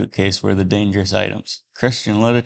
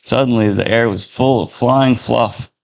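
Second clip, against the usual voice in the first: ordinary delivery, nothing marked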